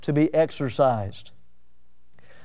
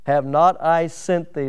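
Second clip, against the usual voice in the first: slow, weighty words of God; God sending Gideon, slow and solemn; slow solemn command; slower than usual. Acted. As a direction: give the command slowly. God's command read slowly.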